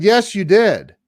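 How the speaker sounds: high retold comeback; insistent contradiction. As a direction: insist right back, acting the friend. Friend contradicts him.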